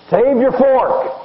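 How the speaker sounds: high, drawn-out call to the room; potluck announcement. Retold or imitated. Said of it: "when they say this".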